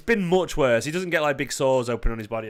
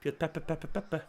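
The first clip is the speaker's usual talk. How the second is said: put-on high, softer toddler babble; imitating toddler son; 3 semitones higher, 8 dB quieter than usual. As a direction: repeat it lightly like a little one. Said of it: "or he says".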